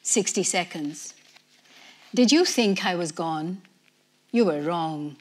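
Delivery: usual speaking voice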